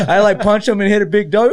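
normal talking voice

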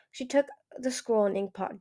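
ordinary delivery

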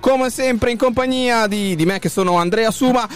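voce alta da presentatore, carica e sostenuta; annuncio radiofonico d'apertura. Acted. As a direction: apri la trasmissione lanciando ogni nome con enfasi. Apertura da presentatore dopo la sigla. (presentatore radiofonico carico, su toni acuti)

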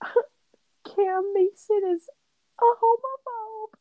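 high, slow mocking accuser voice; mock a scandalized commenter, high and drawn out; mocking internet accuser. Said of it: "They're like".

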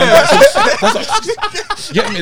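high, loud laughter through the words; can barely get words out for laughing. Real, not acted. Laughing at the dog joke.

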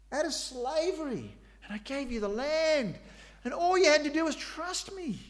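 high, pleading voice of a hurt God; grieved God. Acted. God reminding his people of Egypt, pleading high. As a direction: plead like a wounded parent.

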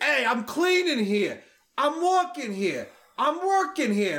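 coworker's hammed-up New York holler, high and stretched; mocking New York accent. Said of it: "found out I was from New York".

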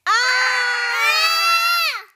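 long high loud wail; drawn-out falling-troll scream; troll's howl. A narrator's reading. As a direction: howl as if swept away. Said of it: "howled the troll".

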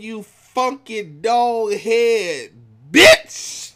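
slow, loud drawn-out insult. Retold or imitated. Quoting [name]'s insult to [name], slow and loud. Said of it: "He said".

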